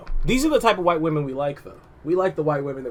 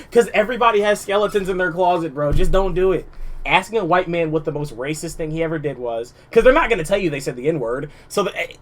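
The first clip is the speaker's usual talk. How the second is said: ordinary delivery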